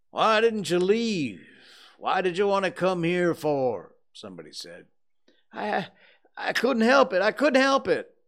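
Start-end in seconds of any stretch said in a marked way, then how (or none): 0.1-3.9 high, loud demand; challenging question. A narrator's reading. Somebody in the crowd to Potter, demanding loudly. Onlooker challenging the accused man. 5.5-8.2 high, loud anguished wail; moaning. A narrator's reading. Potter answering the crowd, moaning helplessly. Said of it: "Potter moaned".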